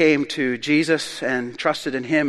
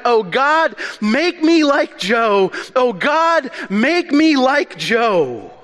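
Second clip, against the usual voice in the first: acted-out repentant cry for help, raised voice; imitated pleading shout; 8 semitones higher, 3 dB louder, slower than usual. Drunk man praying at the mission altar, imitated pleading shout. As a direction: cry out higher, drawn-out, like a desperate prayer. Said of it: "he kept shouting out".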